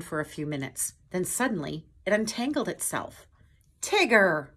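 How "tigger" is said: loud burst of surprised recognition; Pooh exclaiming. A narrator's reading. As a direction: call the name out in surprise. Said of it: "Pooh exclaimed".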